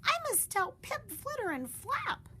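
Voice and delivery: very high eager voice; bursting to share; high thrilled rush; excited. Acted. Excited Stellaluna.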